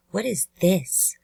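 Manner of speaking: slow, mildly upset question; a bit upset. Shown as a demonstration. Narrator showing how inflection changes meaning, a bit upset. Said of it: "less confused and a bit more upset".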